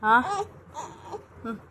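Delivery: singsong parental comforting. Real, not acted. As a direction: sympathize with a tearful little one. Cooing at tearful baby.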